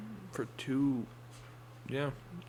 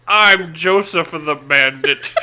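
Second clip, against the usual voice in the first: slow, laughing con man; a swindler who can't keep a straight face; old-timey swindler voice; 7 dB louder, slower than usual. Acted. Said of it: "your old-timey, like, swindler voice".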